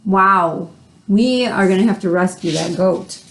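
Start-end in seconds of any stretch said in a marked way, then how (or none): none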